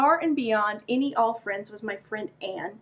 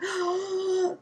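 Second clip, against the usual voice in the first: sharp mock gasp of awe; 6 semitones higher than usual. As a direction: gasp as if unveiling treasure. [name] hands Patrick water.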